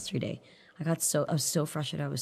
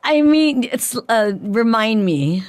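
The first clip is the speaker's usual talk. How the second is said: flustered and loud; 8 semitones higher, 11 dB louder, slower than usual. Real, not acted. Playing innocent about an awkward topic.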